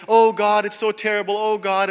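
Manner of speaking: a hint of a whiny plea to God; lightly hammed-up complaint; mock wailing prayer. Preacher mimicking the congregation complaining to God, mildly whiny. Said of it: "we go to God and we say".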